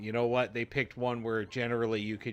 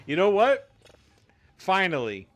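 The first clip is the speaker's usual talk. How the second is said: excited; 8 semitones higher, 14 dB louder than usual. Real, not acted. Sudden excited outburst about Mario.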